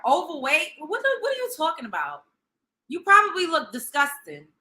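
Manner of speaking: ordinary delivery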